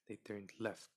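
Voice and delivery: plain reading voice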